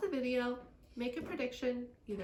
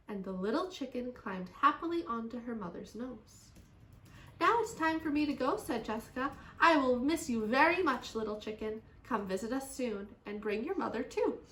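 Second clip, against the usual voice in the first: put-on lively, expressive children's story reading; story read-aloud; 5 dB louder than usual. A narrator's reading. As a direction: read to children with strong emphasis.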